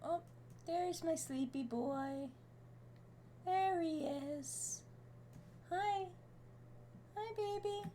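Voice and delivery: high, slow cooing to the cat; pet voice. Real, not acted. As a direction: greet the sleepy cat tenderly. Greeting cat.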